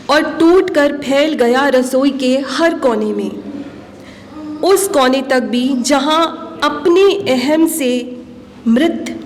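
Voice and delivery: measured poem reading with long pauses; deliberate, expressive poetry reading; poetry recitation. Acted. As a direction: recite line by line, lifting the opening words and letting each line fall. Recites her own poem.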